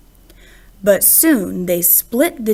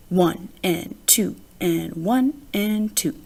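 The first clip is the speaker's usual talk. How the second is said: slow, softer, dragging one-and-two beat; tired plodding count; 3 semitones lower, 5 dB quieter than usual. Acted. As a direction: count it out like a bored piano drill. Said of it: "His fingers planned the same tired tune".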